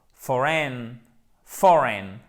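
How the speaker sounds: FOREIGN as FOR-en; strong start on "foreign", short weak ending; first-syllable stress. Shown as a demonstration. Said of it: "l'accent tonique en premier".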